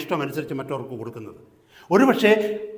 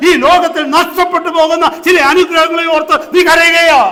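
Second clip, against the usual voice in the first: raised, high pulpit voice building to a shout; fervent full-force preaching; 12 semitones higher, 12 dB louder than usual. Real, not acted. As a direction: challenge the congregation loudly.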